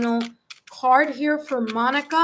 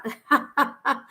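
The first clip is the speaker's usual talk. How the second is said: short burst of amused laughter; 2 semitones higher than usual. Real, not acted. Reader amused by a viewer's comment on the accent, laughing. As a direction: laugh at the comment you just read.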